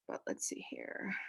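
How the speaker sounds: whispered aside to herself; breathy muttered whisper. Real, not acted.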